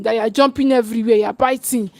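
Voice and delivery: ordinary talk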